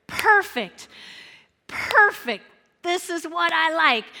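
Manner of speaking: high, loud delighted exclaim; excited Jesus. Acted. Jesus delighted on hearing Lazarus is dead. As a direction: relish it, thrilled. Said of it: "he goes".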